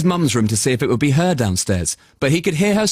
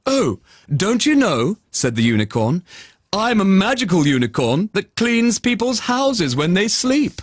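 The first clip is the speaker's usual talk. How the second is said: unicorn voice; 6 semitones higher than usual. Acted. Unicorn answers.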